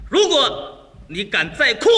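slow, weighty warning; mock threat. Husband warns crying wife, mock threat. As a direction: build up a menacing ultimatum.

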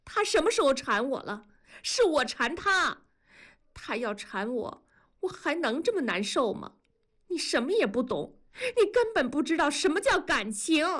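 high, hurt cry near tears; young woman crying out at her friend, near tears; tearful outcry. A narrator's reading. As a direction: shout it with eyes welling up. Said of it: "冲着文丽喊".

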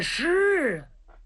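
high, dismayed "是啊"; stunned realization. Acted. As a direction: catch your breath as it sinks in. Zhang Fang sees it's fake.

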